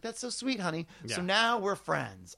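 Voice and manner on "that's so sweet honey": higher, softer, a touch of sugary gratitude; sweet, touched reply. Retold or imitated. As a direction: coo it like a flattered star. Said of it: "she was like".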